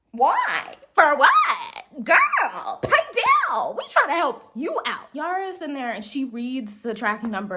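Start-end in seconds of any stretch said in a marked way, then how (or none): 0.1-5.1 high-pitched, exaggerated disbelief; sassy, rising incredulity; mock indignant outburst. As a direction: protest as if wildly affronted. Acted indignant reaction to caller yelling.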